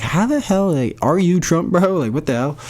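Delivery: high-pitched mock disbelief; incredulous reaction. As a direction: act out a baffled, amazed listener. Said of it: "You're like".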